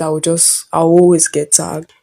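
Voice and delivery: normal talking voice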